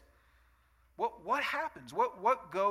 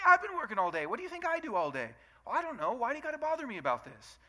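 defensive husband; 8 semitones higher, 3 dB louder than usual. Retold or imitated. Husband defends himself in quarrel.

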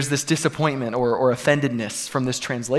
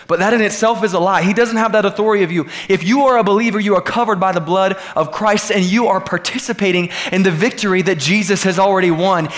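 higher, louder preaching crescendo; full-force preaching; 5 semitones higher, 5 dB louder than usual. Real, not acted. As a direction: drive the declaration home to the congregation. Preaches at a climax.

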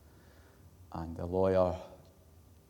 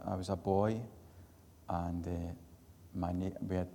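ordinary delivery

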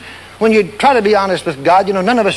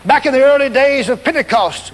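usual speaking voice

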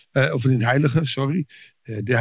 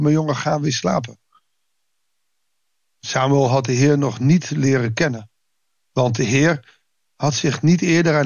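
measured, formal Bible reading voice; lightly formal reading from the pulpit; scripture reading. Acted. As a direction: read the passage to the congregation with calm weight. Reads Bible passage aloud.

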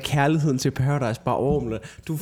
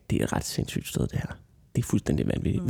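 hushed aside; 8 semitones lower, 6 dB quieter than usual. Retold or imitated. Retold thoughts at first bar.

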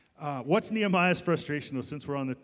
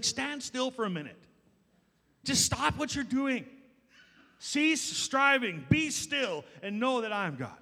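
voicing God; 7 semitones higher than usual. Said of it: "he's just like".

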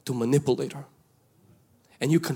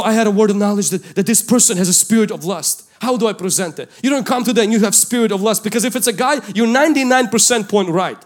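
higher, louder preaching, pressing every point; preaching at full force; 5 semitones higher, 5 dB louder than usual. Acted. Pastor urging the congregation, preaching at full force. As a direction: drive the message home to the crowd.

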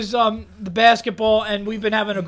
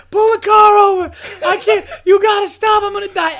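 high, loud mock-panicked cry; retold desperate plea; 8 semitones higher, 6 dB louder than usual. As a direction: imitate a friend begging through laughter. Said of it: "So then James goes".

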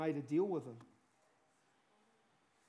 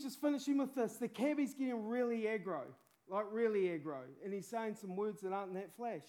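ordinary delivery

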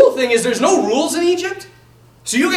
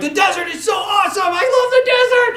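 high, gushing delight; mock excited; 8 semitones higher than usual. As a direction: gush about the desert like a giddy tourist. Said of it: "I was like".

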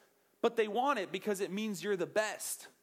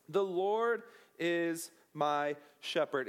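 The Lord said slowly, low and solemn; slow solemn reading; 4 semitones lower, slower than usual. Shown as a demonstration. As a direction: read it slow, like Scripture. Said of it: "starts off like this".

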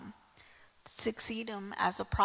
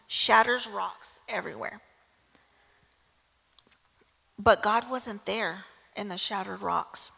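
louder preaching of the storm; 6 dB louder than usual. Acted. Preaching the storm passage, loud.

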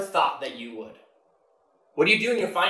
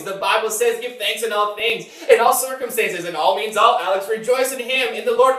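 higher, insistent boasting; raised voice, rattling off verses with total certainty; overconfident believer; 5 semitones higher, 5 dB louder than usual. Retold or imitated. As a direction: imitate a judgmental, relentlessly upbeat churchgoer. Voices judgmental, over-cheerful believer.

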